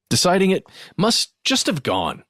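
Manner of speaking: ordinary delivery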